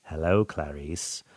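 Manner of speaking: lightly imitated calm killer, soft and slow; a hint of cold, creeping greeting; Hannibal voice. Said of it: "I based my character on Hannibal Lecter".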